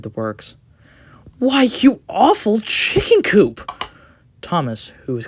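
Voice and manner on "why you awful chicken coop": slow, high, loud indignant insult; hurt outrage. Acted. Thomas hurls a slow, loud insult at the coach. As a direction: spit the insult out slowly, stung.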